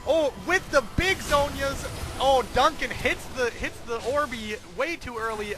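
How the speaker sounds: lively esports commentary; excited play-by-play. Acted. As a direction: call the fight with energy. Excited casting open.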